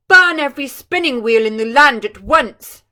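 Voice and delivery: the king roaring an order to the land. Acted. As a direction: bellow the order. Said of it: "roared the king".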